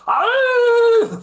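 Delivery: high, loud startled yelp from a scared mate. Retold or imitated. Mate startled in the forest, high loud yelp. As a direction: yelp like something jumped out of the trees.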